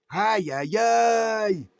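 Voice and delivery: aïe aïe aïe cry. Real, not acted. Sudden exclamation, rising loud.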